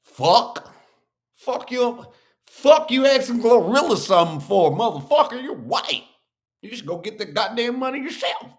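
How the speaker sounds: high, loud, indignant country voice. Acted. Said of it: "I brought out my country voice".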